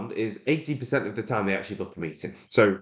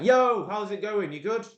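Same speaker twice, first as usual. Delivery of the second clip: high, drawn-out hyped-up "yo"; mock an overly peppy cold caller; over-energetic greeting; 10 semitones higher, slower than usual. Shown as a demonstration. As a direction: far too chirpy, pitched right up. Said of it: "they're gonna really get turned off by that".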